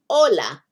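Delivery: "hola" gesproken als een Spaanse groet, oh-la. Shown as a demonstration. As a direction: zeg "hola" met een Spaanse klank. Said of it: "Excuse me, no, you wouldn't learn to say".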